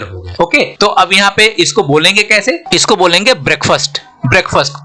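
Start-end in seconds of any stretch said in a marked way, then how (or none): 3.4-4.7 "ब्रेकफास्ट" as brek-fust, k and f run together; joined pronunciation. Shown as a demonstration. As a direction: shorten the last vowel of "ब्रेकफास्ट". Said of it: "के और एफ को एक साथ बोलेंगे". ("ब्रेकफास्ट" को जोड़कर ब्रेक्फ़स्ट बोलें)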